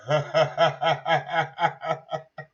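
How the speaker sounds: long, rapid-fire laugh; real laugh. Real, not acted. Host cracking up at his Chinese rumor, laughing. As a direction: laugh in a steady run of ha-ha-has.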